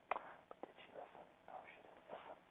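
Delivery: grumbling aside that nobody listened; whispered aside. Real, not acted. Complaining about daughter's mess.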